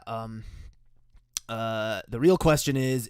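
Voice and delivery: usual speaking voice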